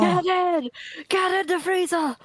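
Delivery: shouting. Acted. Echoing yell at character.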